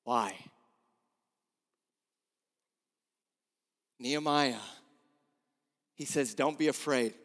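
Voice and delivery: low, soft hush of a preacher; quiet preaching. Acted. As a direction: draw them in softly.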